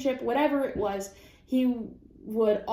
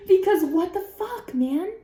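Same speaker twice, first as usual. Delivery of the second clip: outraged exclaim; 9 semitones higher, 6 dB louder than usual. Real, not acted.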